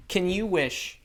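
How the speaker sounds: usual speaking voice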